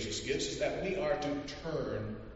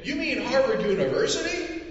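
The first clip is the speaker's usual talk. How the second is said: high, louder incredulous question; amazed question; 12 semitones higher, 6 dB louder than usual. Acted. Census taker checking he heard right, high and incredulous. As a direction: ask it in disbelief.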